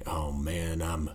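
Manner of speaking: put-on slow, low Western cowboy accent; terrible Western accent. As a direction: drawl it like a bad movie cowboy. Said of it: "speaking with this really terrible Western accent".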